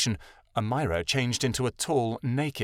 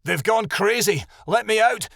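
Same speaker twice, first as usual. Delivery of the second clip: panicked; 9 semitones higher, 4 dB louder than usual. A narrator's reading. Shadowin fleeing in panic.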